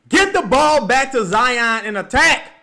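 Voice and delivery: loud, high shouts at the screen; yelling at the team. Real, not acted. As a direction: bark orders at the players on TV. Yelling at the TV game.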